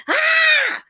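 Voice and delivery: high, loud mock holler; mock scream. Retelling the scared man in the ad, mock scream. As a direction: let out a high shrieking holler. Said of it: "Just starts hollering".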